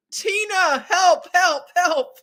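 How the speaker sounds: high, loud hammed-up panic calling Tina; mock cry for help. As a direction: yell for help in fake alarm.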